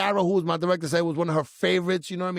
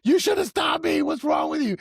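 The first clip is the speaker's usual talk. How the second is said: high mock-furious shout; hammed-up yelling at your wife; wrath; 11 semitones higher, 3 dB louder than usual. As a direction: shriek the blame, then ease down a little. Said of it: "she always gets the wrath".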